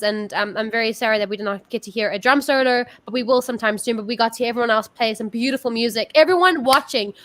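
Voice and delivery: ordinary talk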